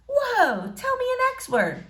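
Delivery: put-on high, eager girlish voice; a girl thrilled to learn a word; excited girl. April asks Nick for a word, high and eager.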